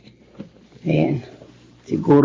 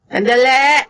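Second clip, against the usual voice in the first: high call to the door; 10 semitones higher, 4 dB louder, slower than usual. Acted. Answering the knock at the door, calling out high.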